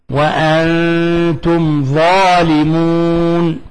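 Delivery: very slow chanted verse; slow recitation. Shown as a demonstration. Teacher recites the example verse, slow chant. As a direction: stretch the verse out calmly. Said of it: "قوله تعالى".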